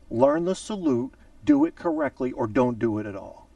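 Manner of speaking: ordinary delivery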